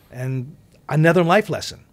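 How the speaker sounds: normal talking voice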